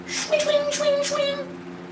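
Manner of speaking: squeaky high whooshing swings; high swing sword noises. Pretend. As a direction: imitate a sword whooshing past.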